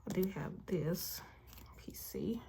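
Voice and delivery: whispered to herself; whispering. Real, not acted. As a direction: murmur it under your breath. Whispered while checking binder.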